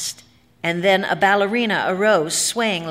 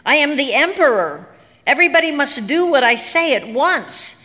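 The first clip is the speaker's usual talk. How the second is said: high, loud commanding declaration; self-proclaimed emperor demanding obedience; imperious cry; 7 semitones higher, 5 dB louder than usual. A narrator's reading. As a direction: order everyone to obey in a raised, ringing voice. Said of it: "cried Harrison".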